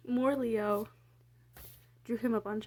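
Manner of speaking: usual speaking voice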